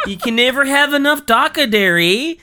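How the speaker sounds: high, loud Canadian orc; hammed-up Canadian with a laughing lift; Canadian accent. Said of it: "but in a Canadian accent".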